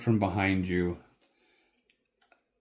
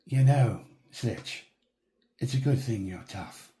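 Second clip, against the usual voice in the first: a hint of a dry, mocking guy; teasing companion. Companion mocking the tumbled rider, dry teasing. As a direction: needle the fallen rider. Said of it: "And the guy goes".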